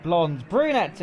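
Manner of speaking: hammed-up intro voice, drawn out and loud; Soup Crawler's intro. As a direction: stretch the hello out like a channel intro host. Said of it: "I've done Soup Crawler's intro".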